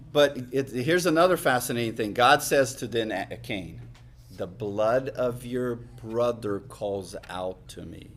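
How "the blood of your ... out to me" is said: 1 solemn voice of God. Retold or imitated. Voicing God's words.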